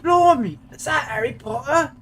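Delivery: high, loud exaggerated British exclamations; mock British surprise; British accent. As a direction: ham up a posh British schoolboy. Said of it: "Neville British".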